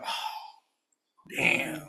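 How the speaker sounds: long breath, then slow soft admission; caught out, deflated. Retold or imitated. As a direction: act out the sinking realization of being busted. Acts deflated at being caught out.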